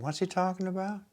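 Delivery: high, hushed imitation of bewilderment; baffled brothers. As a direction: squeak it softly, as if totally confused. Baffled brothers react to Joseph.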